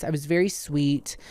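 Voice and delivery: usual speaking voice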